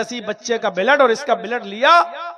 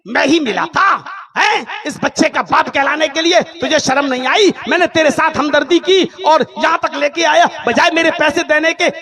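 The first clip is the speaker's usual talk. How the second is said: loud, higher outraged rant; angry yelling; 6 semitones higher, 5 dB louder than usual. Acted. Taxi driver yelling at the lying woman, outraged. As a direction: blast her for the lie.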